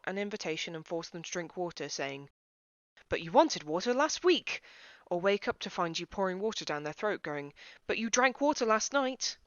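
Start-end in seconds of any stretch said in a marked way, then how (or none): 3.1-4.6 indignant insisting. Acted. Forcing water on unwilling person. 7.9-9.4 indignant insisting. Acted. Forcing water on sleeping person.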